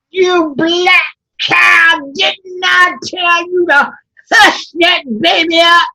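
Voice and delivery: cruel overseer shouting, slow and loud on the insult; furious shout. Acted. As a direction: bark it at the woman in the field. Overseer yelling at Sarah.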